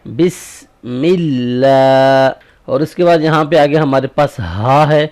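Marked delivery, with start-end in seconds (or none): 0.1-2.3 اللہ with the alif stretched long in slow recitation; slow tajweed recitation; long vowel demo. Shown as a demonstration. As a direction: lengthen the a in اللہ. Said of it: "کھڑی زبر ہے تو اس کو ہم نے لمبا".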